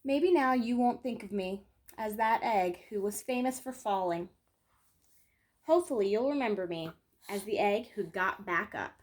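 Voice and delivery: gentle picture-book read-aloud, lingering on key moments; storytelling. Acted. Reading a children's book to her viewers, gentle storytelling. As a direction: tell it like a bedtime story for kids.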